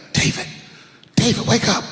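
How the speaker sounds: mild high urgent wife voice; lightly voiced wife shaking him awake; wife waking him.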